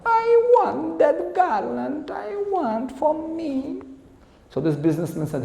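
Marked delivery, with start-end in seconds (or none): 0.0-3.8 very high, slow, drawn-out mock female voice; demanding girlfriend. Girlfriend begs businessman for the garland, high demanding whine. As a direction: stretch out a high-pitched, spoilt demand. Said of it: "And this girlfriend said".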